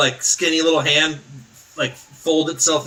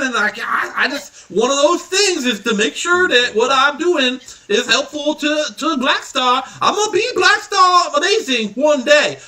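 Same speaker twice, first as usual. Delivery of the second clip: put-on high, breathless eager boy; eager kid voice; 10 semitones higher than usual. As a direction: gush like a starstruck little kid.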